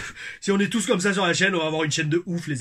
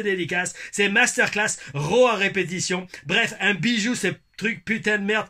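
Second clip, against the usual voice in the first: put-on Québécois accent; 4 semitones higher than usual. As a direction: ham up the Québécois accent, higher. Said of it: "mon accent québécois". (lecture du commentaire avec accent québécois)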